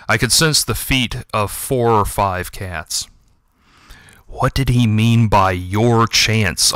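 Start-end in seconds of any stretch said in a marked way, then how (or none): none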